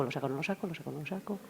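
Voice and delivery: soft hesitant back and forth; weighing it up. Retold or imitated. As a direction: mull it over under your breath. Inner balance voiced, deliberating. (dudando en voz baja entre sacarlo o no)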